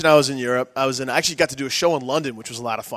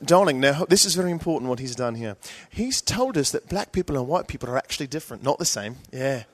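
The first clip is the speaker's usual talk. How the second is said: British audience member. Retold or imitated. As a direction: explain awkwardly in a put-on British accent. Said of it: "this is what the crowd would do".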